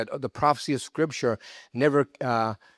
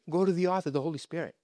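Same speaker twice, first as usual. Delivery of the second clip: normal talking voice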